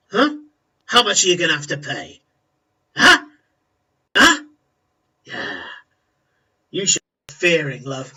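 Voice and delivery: menacing thug mocking a debtor; mocking enforcer. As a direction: jeer at her, rising on each huh. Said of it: "He goes".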